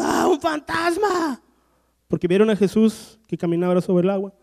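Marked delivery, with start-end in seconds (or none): 0.0-1.4 high, slow startled cry of the disciples; hammed-up terrified disciples spotting a ghost; frightened cry. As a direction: gasp it out as if you've seen a ghost.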